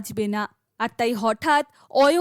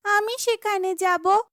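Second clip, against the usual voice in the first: put-on raised, eager girl's voice; lightly higher heroine voice; young heroine Tara; 7 semitones higher than usual. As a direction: ask it like a brave young girl.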